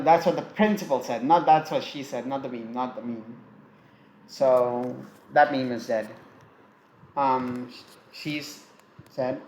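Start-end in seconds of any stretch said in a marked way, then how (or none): none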